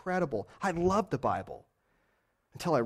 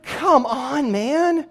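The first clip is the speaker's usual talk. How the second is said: higher, louder exasperated plea; 9 semitones higher, 6 dB louder, much slower than usual. Retold or imitated. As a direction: groan it out in exasperation. Exasperated at Numbers.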